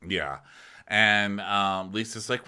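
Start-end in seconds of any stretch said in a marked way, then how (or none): none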